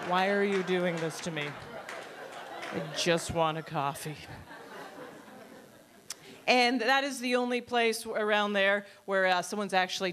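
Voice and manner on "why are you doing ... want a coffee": lower, worn-down pleading; resigned, sinking exasperation; weary plea. Acted. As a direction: plead as if you've given up.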